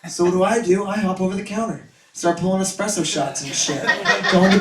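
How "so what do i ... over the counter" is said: ordinary talk, nothing marked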